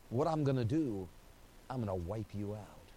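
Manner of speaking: a hint of divine doom, spoken low; ominous quiet God. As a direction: sink to a dark, soft threat. God's verdict voiced.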